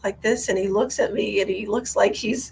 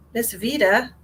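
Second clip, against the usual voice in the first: normal talking voice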